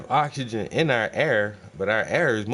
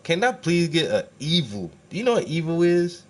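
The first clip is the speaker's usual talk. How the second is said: exasperated demand; 6 semitones higher, 4 dB louder than usual. Real, not acted. Demanding an evil assistant.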